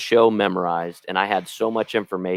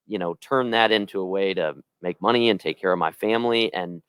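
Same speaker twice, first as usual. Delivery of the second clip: normal talking voice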